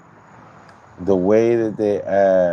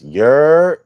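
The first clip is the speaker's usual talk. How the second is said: loud, higher Australian "Yer" for yeah; mock Australian "yer"; 8 semitones higher, 8 dB louder than usual. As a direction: punch out an Aussie "Yer". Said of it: "had to be an Australian".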